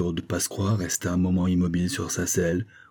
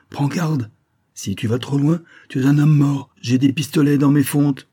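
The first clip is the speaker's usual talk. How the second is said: higher, louder menacing warning; angry threat; 8 semitones higher, 6 dB louder than usual. Acted. Victor gripping Cardasol's arm, threatening him angrily. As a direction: threaten him with death, voice rising.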